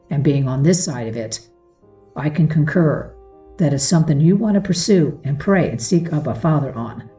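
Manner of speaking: usual speaking voice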